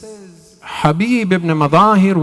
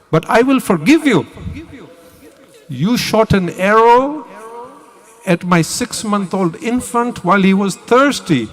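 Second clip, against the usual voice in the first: raised, swelling cry of forgiveness; impassioned plea; 7 semitones higher, 4 dB louder than usual. Retold or imitated. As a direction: plead with your killers to save themselves, voice climbing high. Hussein pleads with his killers, retold.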